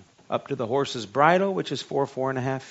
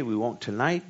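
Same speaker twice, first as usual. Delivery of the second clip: ordinary talk, nothing marked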